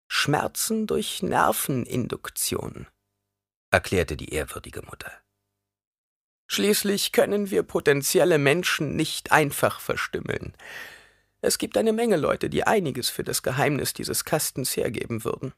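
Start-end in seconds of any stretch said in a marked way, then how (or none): 0.1-2.9 high, slow crone voice; old woman stretching out an explanation, pitched high; explaining old woman. A narrator's reading. Reverend mother explains the pain to the boy, high and slow. 6.5-15.5 high-pitched crone explaining; old woman's voice, pitched well up; matter-of-fact old woman. A narrator's reading. Reverend mother explains the box's secret, high crone voice.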